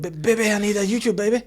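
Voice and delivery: high, fast, pleading; a junkie begging for a fix; mock addict kid. Craving child begging for YouTube, mock junkie voice. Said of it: "you're like".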